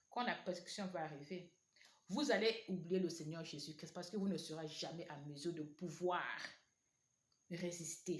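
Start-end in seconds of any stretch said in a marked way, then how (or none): none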